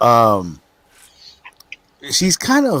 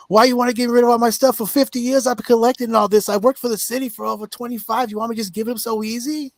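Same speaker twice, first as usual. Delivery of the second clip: put-on high pitched voice of a grumpy old city worker; silly old worker voice; 13 semitones higher than usual. Daughter playing an old city worker to dodge cleaning, in a high mock voice. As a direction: play a cranky old retiree, pitched way up. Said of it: "She'll say something silly like".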